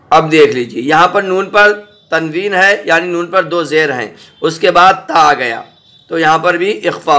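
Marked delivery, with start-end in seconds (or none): none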